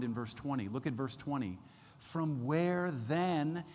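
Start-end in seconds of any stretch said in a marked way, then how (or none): 2.0-3.6 drawn-out, exasperated question. Acted. Preacher voicing Job's question on wisdom's source, exasperated. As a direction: stretch each word slowly in exasperation. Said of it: "this kind of exasperated tone".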